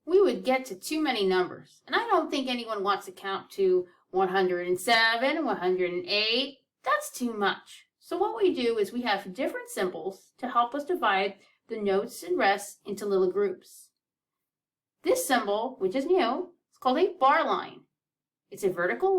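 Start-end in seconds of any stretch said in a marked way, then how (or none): none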